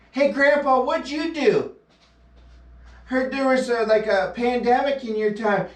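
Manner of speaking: put-on a grandkid asking about the old days; voicing a grandchild.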